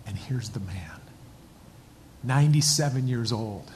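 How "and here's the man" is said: hushed whisper. Real, not acted. Pastor whispering as the veteran appears, hushed. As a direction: whisper it, voice dropped away. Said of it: "hushed reveal of the veteran".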